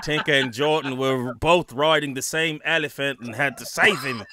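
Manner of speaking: a loud, clumsy attempt at an Irish accent that was meant to be Aussie; hammed-up Irish-sounding lilt, loud; bad Irish accent. Host hamming a bad Irish-sounding accent for the panel. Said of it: "the worst Irish accent I've ever heard".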